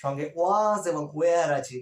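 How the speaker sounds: was এবং were ধীরে স্পষ্ট করে; শব্দ দুটো ধীরে টেনে বলো; stressed was and were. Shown as a demonstration. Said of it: "তার সঙ্গে দেখলে". (ধীরে জোর দিয়ে was, were)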